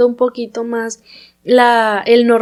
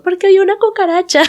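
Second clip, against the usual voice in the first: voz de niña; 8 semitones higher, 3 dB louder than usual. Retold or imitated. Imita a la sobrina asustada.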